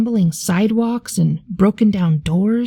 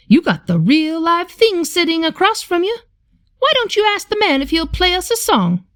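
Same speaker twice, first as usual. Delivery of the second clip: eager grandmother; 9 semitones higher than usual. Acted. Nana nudges the boy.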